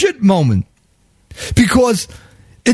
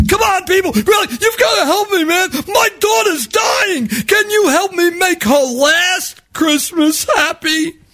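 yelling out to the car; 9 semitones higher than usual. Retold or imitated. Panhandler begging the subway riders for money. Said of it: "this guy's yelling out".

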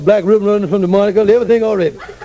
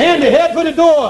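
higher-pitched panicked command; urgent alarm; 5 semitones higher than usual. Acted. Kingfish tells Andy to run for it, panicked. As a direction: urge him to flee at once. Urging escape as brother is summoned.

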